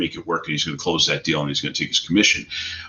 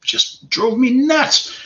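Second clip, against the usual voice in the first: a stretched-out, much higher exasperated cry; 3 dB louder, much slower than usual. Real, not acted. Veteran venting about scuzzy industry, exasperated high cry. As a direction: let the frustration burst out slowly.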